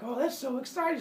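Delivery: high, gushing mock delight; lightly hammed-up excitement; excited reaction. Friends react to Semele's secret, high and excited. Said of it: "they reacted so positively".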